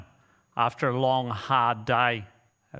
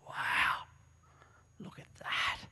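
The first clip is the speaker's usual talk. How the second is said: hushed, breathy whisper of wonder; awed whisper; 4 semitones lower, 9 dB quieter, slower than usual. Acted. Eaglet spotting an eagle overhead, awed whisper. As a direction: whisper it in amazement at the sight. Eaglet awestruck at soaring bird.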